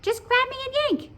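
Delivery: very high, squeaky little voice; high-pitched cartoon pigtail. A narrator's reading. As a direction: squeak it like a tiny talking pigtail. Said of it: "said the pigtail".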